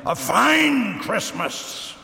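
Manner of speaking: high, drawn-out sarcastic outburst; exasperated exclamation. A narrator's reading. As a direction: stretch it out in exasperation, pitched high. Householder exclaims in smoke-filled room.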